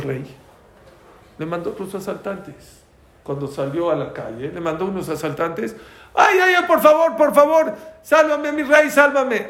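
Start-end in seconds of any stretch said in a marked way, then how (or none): 6.1-9.5 high, loud panicked begging; girl under attack pleading with her king, shrieking high and loud; desperate plea for help. Retold or imitated. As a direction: scream for the king to save you.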